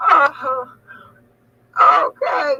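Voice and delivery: loud, laughing okay; laughing shout. Real, not acted. Winding down from laughter.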